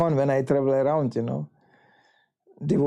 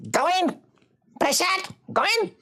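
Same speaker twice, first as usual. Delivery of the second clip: commanding shout. Retold or imitated. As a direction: bark it like a matron who won't take no. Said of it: "was going".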